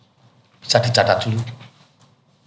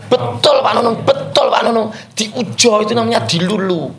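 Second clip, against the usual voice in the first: excited agreement; 12 semitones higher than usual. Real, not acted. Excited reply to audience.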